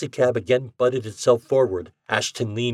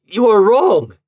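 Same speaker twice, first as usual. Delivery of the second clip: high, loud desperate cry; cry of protest; 12 semitones higher, 11 dB louder, slower than usual. A narrator's reading. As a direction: cry out in contradiction. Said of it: "he cried".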